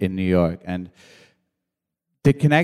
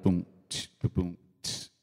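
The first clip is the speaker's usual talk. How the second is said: soft mouth-made kick and tambourine; beatbox a sparse boom-tch quietly; imitated drum beat; 2 semitones lower, 10 dB quieter than usual. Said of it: "I just put a little".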